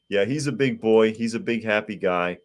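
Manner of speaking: ordinary delivery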